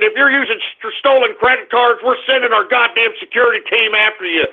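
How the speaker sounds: ordinary talk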